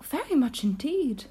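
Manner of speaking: lightly voiced girl; Alice. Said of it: "said Alice".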